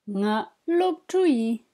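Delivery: slow, careful "Nga lopchui", each syllable drawn out; slow model. Shown as a demonstration. Teacher models a phrase for students, slow and careful. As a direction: lay out "Nga lopchui" slowly for learners. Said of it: "Une phrase qui vous sera certainement utile".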